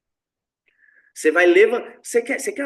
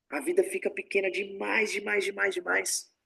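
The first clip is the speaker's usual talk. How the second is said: fading repetition; 7 dB quieter than usual. Shown as a demonstration. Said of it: "repeats fading, emphatic".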